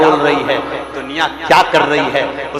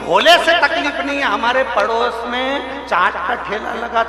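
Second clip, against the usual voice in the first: high-pitched acted grievance; exasperated complaint; 9 semitones higher than usual. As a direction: play the put-upon brother-in-law explaining himself. Brother-in-law's exasperated reply, acted. (खीझ भरी ऊँची शिकायत, अभिनय में)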